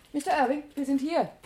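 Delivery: calling out. Acted. Assistant calls her boss over, loud call. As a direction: call him over from a distance.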